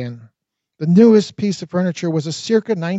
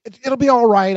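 calling after; 5 semitones higher, 4 dB louder than usual. A narrator's reading. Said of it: "I called after her".

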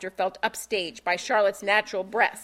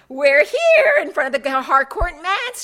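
peppy newscaster voice, louder and higher; bubbly; 6 semitones higher, 9 dB louder than usual. Acted. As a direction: bubble it out like a cheery TV anchor. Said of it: "she bubbled".

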